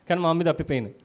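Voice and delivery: ordinary talk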